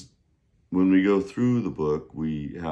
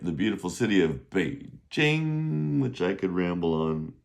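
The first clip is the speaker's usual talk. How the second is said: ordinary delivery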